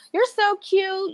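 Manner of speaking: high, slow, sugary mock-cooing; cooing compliment. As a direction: coo it like gushing admirers. People complimenting her, quoted.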